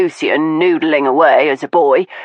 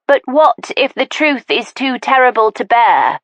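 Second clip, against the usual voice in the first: a touch of surrender, higher; 7 semitones higher than usual. Acted. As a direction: give in, fearful. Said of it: "in a voice of surrender".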